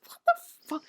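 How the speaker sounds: high-pitched what the fuck; disbelieving squeal. Retold or imitated. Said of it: "And I'm like".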